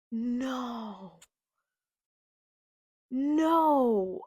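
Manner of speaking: drawn-out high "no", building in volume; horrified. Real, not acted. Reader seeing half his face gone, horrified. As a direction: wail it in growing dismay.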